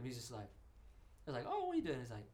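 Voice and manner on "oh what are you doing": squeaky surprised greeting; high quoted greeting. Retold or imitated. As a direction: pitch the greeting way up in mock surprise. Retold meeting, high quoted voice.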